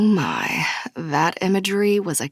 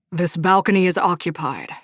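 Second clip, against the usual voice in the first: lightly voiced irritated stranger guarding his spot; mildly gruff masculine warning; gruff pissed-off man. A narrator's reading. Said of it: "a gruff and pissed-off masculine voice".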